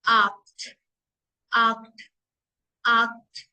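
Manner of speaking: आक्ट said "akt" with a hard k; German acht. Shown as a demonstration. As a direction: drill आक्ट three times. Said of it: "eight".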